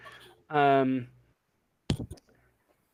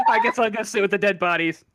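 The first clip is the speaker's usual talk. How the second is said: laughing; 7 semitones higher, 6 dB louder than usual. Real, not acted. Player jokes through laughter.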